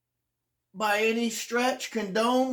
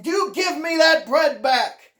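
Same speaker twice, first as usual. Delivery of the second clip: mother's furious yell, loud and high; retold angry yell; 6 semitones higher, 6 dB louder than usual. Foster mother yelling at the hungry boy, loud and angry. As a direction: bark it back at him like an angry foster mother. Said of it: "she yelled at me".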